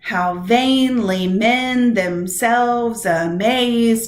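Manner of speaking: slow scansion, hitting VAIN, MEN, SELVES, MAZE in "How vainly men themselves amaze"; exaggerated iambic stress. Shown as a demonstration. Teacher letting students hear the meter, slow exaggerated iambic beat. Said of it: "Do you hear what I hear? It is that iambic".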